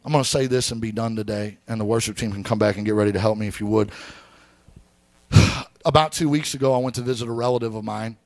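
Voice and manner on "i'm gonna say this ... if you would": usual speaking voice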